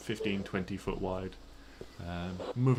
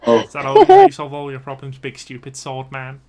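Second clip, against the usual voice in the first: louder goblin jeer; goblin mockery; 5 semitones higher, 13 dB louder than usual. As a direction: taunt the swordsman like a goblin. Goblin insults the paladin.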